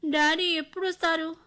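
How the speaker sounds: put-on a hurt child begging for her father; pitiful plea. A narrator's reading. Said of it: "దీనంగా అడుగుతోంది సిరి". (దీనంగా వేడుకునే పాప గొంతు)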